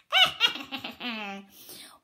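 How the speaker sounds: loud gleeful witch cackle. Shown as a demonstration. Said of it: "Can you give me a cackle of glee?".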